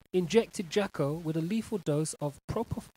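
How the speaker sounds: normal talking voice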